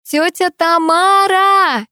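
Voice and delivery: high, drawn-out call to a neighbour. A narrator's reading. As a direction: shout the name slowly across a courtyard. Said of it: "крикнула я".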